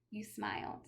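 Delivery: ordinary delivery, nothing marked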